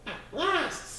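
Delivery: high, triumphant bug cry; gleeful bug. A narrator's reading. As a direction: crow it out with glee. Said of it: "sang the quagga bug".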